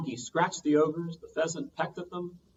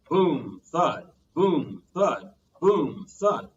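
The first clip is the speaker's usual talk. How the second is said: loud, heavy stomping sounds; booming footsteps; 3 semitones higher, 8 dB louder, much slower than usual. A narrator's reading. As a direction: boom each word like a giant's step. Giant footsteps sound effect.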